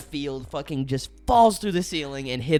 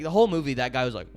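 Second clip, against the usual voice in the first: ordinary talk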